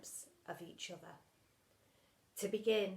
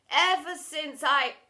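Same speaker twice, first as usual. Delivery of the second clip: louder, slow, swelling declaration; grand proposal restart; 4 semitones higher, 11 dB louder, slower than usual. Acted. Mister Lovey restarting his proposal before the school, louder and grander. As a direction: start the speech big.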